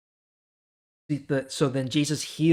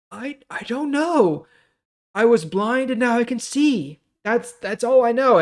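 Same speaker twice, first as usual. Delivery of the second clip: much higher, halting and bewildered; flustered healed man; 9 semitones higher, 4 dB louder than usual. Retold or imitated. Healed man answers the Pharisees again, high and bewildered. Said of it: "And the man's just like".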